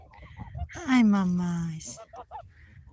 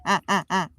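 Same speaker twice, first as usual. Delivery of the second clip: ordinary talk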